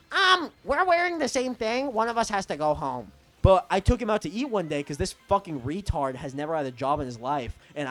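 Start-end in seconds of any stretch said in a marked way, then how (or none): 0.1-3.1 high-pitched, put-on snooty complaint; hammed-up fussiness over a trifle; mock-fussy objection. Mocking someone upset at matching hoodies, mock-fussy objection.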